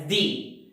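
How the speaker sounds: "the" with an ee vowel, like "dee"; "the" as thee. Shown as a demonstration. Teacher applies rule to an example, "the" as thee. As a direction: say "the" to rhyme with see. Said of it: "you have to pronounce this word as".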